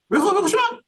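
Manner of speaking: imitating a grandmother's high-pitched blessing. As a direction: chirp "Baruch hu u'baruch shemo" up high like an old grandmother. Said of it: "in this high-pitched voice".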